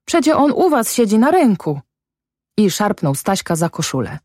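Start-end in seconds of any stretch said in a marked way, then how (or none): 0.0-1.8 high-pitched protest; crying out. A narrator's reading. Son protests to his father, high-pitched cry. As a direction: protest urgently, pitched well up. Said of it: "krzyknął".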